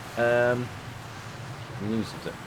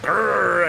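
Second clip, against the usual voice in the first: loud mock bear growl, pitched up; 8 semitones higher, 6 dB louder than usual. Player mimicking the bear's roar to viewers, loud mock growl. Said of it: "goes like".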